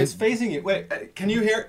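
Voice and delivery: talking from behind the mic; distant, off-axis voice. Real, not acted. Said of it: "If I talk from the back of your microphone".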